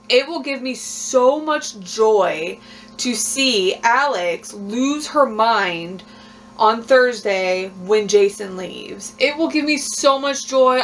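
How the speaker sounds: slow gloating. Real, not acted. Relishing rival's coming meltdown.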